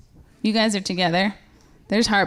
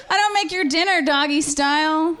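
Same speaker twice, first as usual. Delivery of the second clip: normal talking voice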